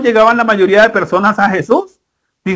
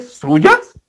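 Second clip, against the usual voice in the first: much higher, questioning the woman; mock surprise; 12 semitones higher than usual. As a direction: feign astonishment to make a point. Friend challenges the woman.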